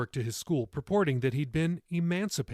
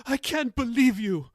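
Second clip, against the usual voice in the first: high, louder, anguished cry; 10 semitones higher, 5 dB louder than usual. A narrator's reading. Grandmother crying at grandson, anguished. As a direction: cry it out in hurt. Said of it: "she cried".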